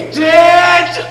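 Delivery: high, loud outraged did; stagey astonishment; a disbelieving shout; shocked disbelief. Acted. Pirate learns orphan lie.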